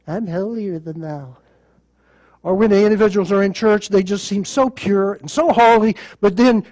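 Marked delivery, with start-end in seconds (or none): 0.0-1.4 smug, soft holier-than-thou voice; mock the pious snob; a hint of a snooty churchgoer. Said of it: "everybody's walking around".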